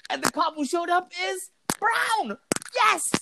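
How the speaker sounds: very high, drawn-out celebration; gleeful triumph. Real, not acted. Telling friend the cop was brown, triumphant squeal. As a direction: squeal the payoff like a victory.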